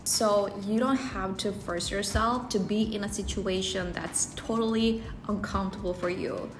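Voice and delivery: normal talking voice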